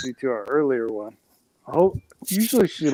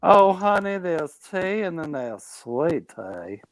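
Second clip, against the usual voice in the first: mock southern accent; 2 semitones higher, slower than usual. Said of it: "Don't make fun of me for my southern accent".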